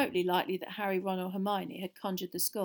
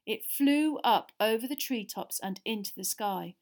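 ordinary delivery, nothing marked

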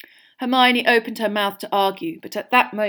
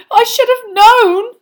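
slow, high, loud wail; tragic; 15 dB louder, slower than usual. A narrator's reading. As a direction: lament it like a tragedy. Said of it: "said Lavender tragically".

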